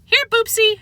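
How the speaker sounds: high sing-song coaxing; old lady luring a cat. A narrator's reading. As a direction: coax the cat sweetly.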